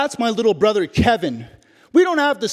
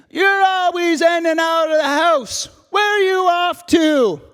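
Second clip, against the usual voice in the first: a mother's raised nagging call; 8 semitones higher than usual. Acted. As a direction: call across the house, nagging. Mom calls out to son.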